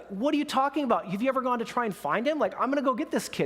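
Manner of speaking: high-pitched, incredulous acted retort; indignant retort. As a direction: challenge them in disbelief, voice jumping up. John indignant, retold heated exchange.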